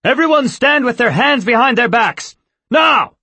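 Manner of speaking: high, loud command shout. A narrator's reading. Captain ordering the board to stand, shouting. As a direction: bellow at the whole board.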